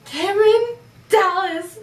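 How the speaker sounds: high, loud, drawn-out name; excited squeal. Real, not acted. Friend gushing over meeting Cameron Dallas, high and loud.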